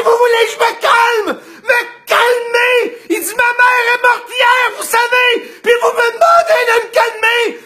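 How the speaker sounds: shouting at the orderly who told him to calm down; angry outburst. Retold or imitated. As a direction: yell it back at him, outraged. Patient exploding at nurse.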